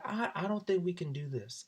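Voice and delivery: a hint of a sulky college girl; mock upset girl. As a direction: play it like a hurt girlfriend.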